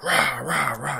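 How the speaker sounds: ordinary talk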